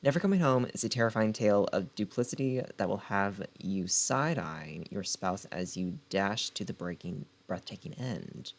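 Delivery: dramatic book-blurb narration; blurb read. Acted. Reading the back cover to her viewers, dramatic blurb read. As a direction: sell the story like a trailer.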